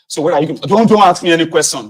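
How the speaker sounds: loud irritated snapping; snapping at the family not to ask questions; angry snap. Retold or imitated. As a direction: cut them off sharply. Snapping at family while leaving.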